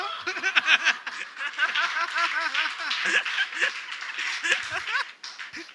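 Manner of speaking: long trailing laugh; real laugh that runs on; helpless drawn-out laughing at own joke; laughter. Real, not acted. Laughing at own food prophet joke.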